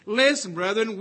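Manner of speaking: usual speaking voice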